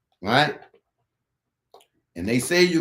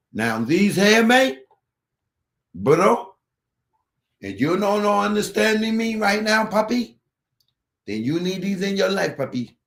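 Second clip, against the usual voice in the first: Spanglish hype; 6 semitones higher than usual. Pretend. Salesman hyping earbuds to viewers, mock Spanglish. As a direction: sell it like a street vendor with Spanish flair. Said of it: "it's the Spanglish".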